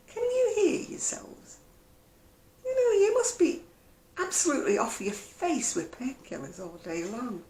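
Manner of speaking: stare at them in disbelief; incredulous thought. Retold or imitated. To pill-swapping acquaintances, silently incredulous. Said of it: "I'm thinking".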